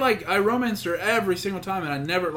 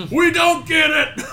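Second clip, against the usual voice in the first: loud, high, slow drawn-out holler; hammed-up clueless outcry; mock yell; 11 semitones higher, 8 dB louder, much slower than usual. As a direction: shout it as a joke through laughter. Joking outburst amid laughter, drawn out.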